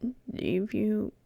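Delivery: quiet, low, hesitant Chifley voice; nervous Chifley asks for time; Chifley in character. Acted. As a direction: ask shyly, voice dropped low. Chifley's lines in play.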